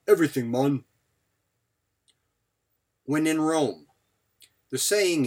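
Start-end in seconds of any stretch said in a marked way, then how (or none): 0.1-0.8 lightly voiced Jamaican accent; a hint of Jamaican lilt, rising at the end. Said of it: "I got dreadlocks and the accent".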